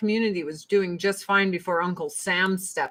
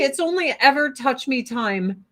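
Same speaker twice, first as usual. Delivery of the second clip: ordinary delivery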